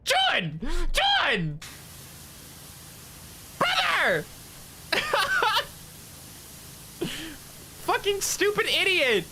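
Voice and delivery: shrieking his friend's name, pitch way up; high-pitched yelling. Retold or imitated. Streamer shrieking at his friend over a silly clip, high frantic yell. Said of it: "And I'm like".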